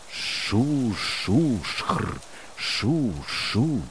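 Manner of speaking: hushing sound of rustling corn; imitated rustling. A narrator's reading. Narrator imitates corn rustling around the boy, hushed rustle. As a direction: softly imitate leaves rustling, in separate bursts. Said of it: "шурхотить кукуруза".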